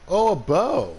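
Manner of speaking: high, loud delighted cry; thrilled at finding it; excited. Real, not acted. Finds a bow in game.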